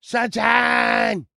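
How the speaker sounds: high, loud call; calling out to Sunshine off-mic. Real, not acted. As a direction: shout her name across the room.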